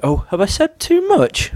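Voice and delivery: high, slow, coy question; put-on high coy voice; mock innocence. Mock innocent after a slip.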